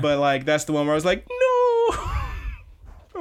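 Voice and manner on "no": very high, drawn-out "no"; mock-anguished cry on the edge of laughter; heartbroken wail. Reliving reaction to sad scene.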